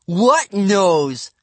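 high, loud, jeering question; mocking cry. A narrator's reading. Bradley teases Livy about her nose, jeering loudly. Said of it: "he cried".

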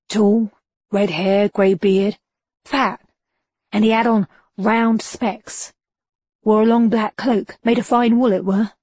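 a hint of a cocky street rogue, pleased with his pun; cheeky petty crook, lightly done; lightly voiced London informant. A narrator's reading. Billy describes the toff.

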